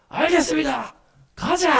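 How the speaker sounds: high, eager battle cry; Michael. Acted. Archangel obeys and leads the army off, high rallying call. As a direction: answer the order, then rally the troops. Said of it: "미카엘이잖아요".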